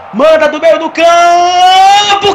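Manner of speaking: slow, loud, drawn-out goal call; explosive goal commentary. Real, not acted. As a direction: erupt as the ball hits the net. Long-range equaliser scored live.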